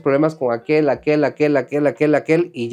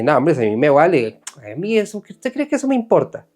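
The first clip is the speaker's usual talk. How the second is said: mock indifference; 3 semitones higher than usual. Preacher mimics people who claim not to care, dismissively.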